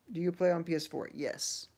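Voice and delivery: normal talking voice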